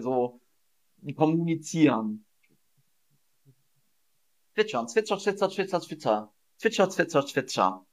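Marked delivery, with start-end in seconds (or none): 4.5-7.9 chirping imitation. Shown as a demonstration. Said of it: "Zwitschern ist das Geräusch".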